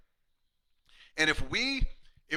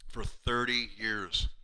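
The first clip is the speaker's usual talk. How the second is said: "For thirty years" drawn out slowly, lower; slow emotional emphasis; 4 semitones lower, much slower than usual. Retold or imitated. Patient telling his chiropractor how long, slow and moved. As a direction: linger on "thirty years" as if choked up. Patient's tearful thanks retold.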